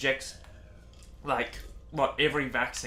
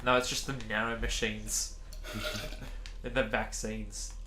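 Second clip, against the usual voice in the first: sarcastic; 3 semitones lower, 9 dB quieter than usual. Real, not acted. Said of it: "That is sarcasm, by the way".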